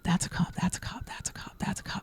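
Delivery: rapid-fire repeated whispered warning; urgent whisper. Retold or imitated. Warning friend's girlfriend about the cop, urgent whisper. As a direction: hiss it secretly under your breath.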